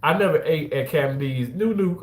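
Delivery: ordinary talk, nothing marked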